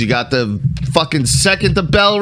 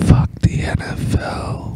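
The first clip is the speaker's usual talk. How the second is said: whisper. Real, not acted. Whispered aside during intro.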